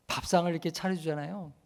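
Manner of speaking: usual speaking voice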